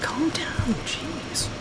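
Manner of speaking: exasperated calming. Retold or imitated. Player answering her complaining game character, hushed exasperation. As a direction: grumble it under your breath. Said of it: "I'm like".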